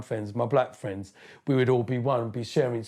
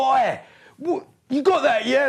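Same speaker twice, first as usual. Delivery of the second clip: very high, loud hail; eager mock hail; 14 semitones higher, 6 dB louder than usual. DJs asking him for his tune, high excited mimicry. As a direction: call out like a DJ after a new tune.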